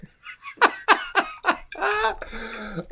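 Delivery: a real high laugh at his own joke; high burst of laughter. Real, not acted. Painter cracking himself up at his own joke, high laugh. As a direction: crack up at your own idea. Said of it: "I make myself laugh".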